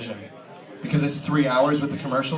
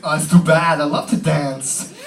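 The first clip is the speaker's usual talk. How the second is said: Christopher Walken voice; 5 dB louder, slower than usual. Pretend. Walken quoted replying to agent.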